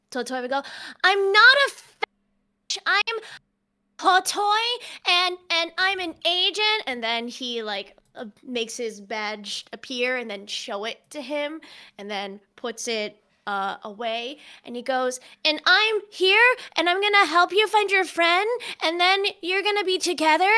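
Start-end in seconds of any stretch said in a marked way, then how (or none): 1.0-6.8 high, loud, halting protest; indignant child character. Acted. Totoi correcting Cleven's mistake, indignant childlike voice. 15.4-20.5 high, loud, rising run-on phrases; excited child character. Acted. Totoi promising to find a friend, excited childlike voice.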